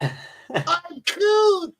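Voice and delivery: very high, put-on cute voice; mock-adorable falsetto; cutesy voice. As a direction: squeal it playfully, far above normal pitch. Joking reply to "it's cute.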